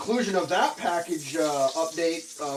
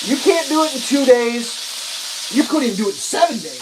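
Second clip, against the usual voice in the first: higher and louder, building exasperation; retold indignant protest; 6 semitones higher, 7 dB louder than usual. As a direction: push back hard on the offer. Arguing with support agent.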